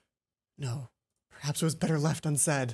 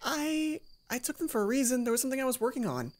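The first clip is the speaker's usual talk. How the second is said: put-on much higher girl's voice, defensive; flustered Mai; 11 semitones higher than usual. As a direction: Mai stammering her excuse.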